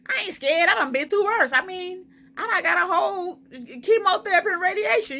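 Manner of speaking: high, confident bravado; retold bravado. Recalling how fearless she first felt, high bravado. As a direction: replay her earlier fearless self. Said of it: "I was like".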